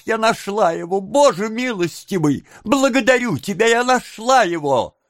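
put-on high, wailing young woman; overwrought exclamations. A narrator's reading. Young woman claiming runaway Oliver, overwrought cries. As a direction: gush and lament in a high voice. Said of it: "После этих бессвязных восклицаний".

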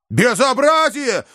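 high, slow outraged yell. A narrator's reading. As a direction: bellow in outrage. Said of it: "вдруг завопил Иван".

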